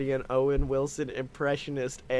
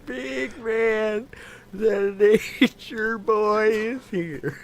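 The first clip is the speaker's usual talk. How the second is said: wrestler announcer; 5 semitones higher, 6 dB louder, much slower than usual. Pretend.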